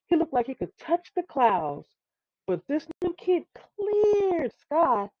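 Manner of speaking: usual speaking voice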